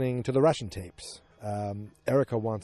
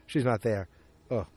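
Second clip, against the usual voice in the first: ordinary delivery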